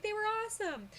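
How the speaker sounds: gushing fan. Pretend. Imagined gushing review.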